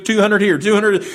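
auctioneer calling out. Retold or imitated.

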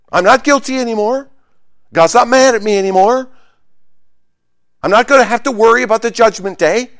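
loud, high-pitched, jubilant relief; giddy, emphatic celebration; exultant declaration. Acted. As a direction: proclaim it high and loud, like someone just set free. Voices the freed believer's exultation.

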